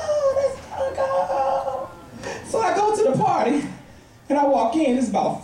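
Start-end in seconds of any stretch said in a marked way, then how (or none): 0.0-1.9 high, drawn-out cry of delight; excited child's squeal. Acted. Niece greeting her cool uncle at the party, high excited squeal. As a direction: stretch it out in a high child's gush.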